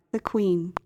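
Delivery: usual speaking voice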